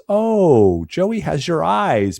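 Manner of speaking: high, slow, sugary gushing; gushing mom voice. Retold or imitated. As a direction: coo it like a doting mother.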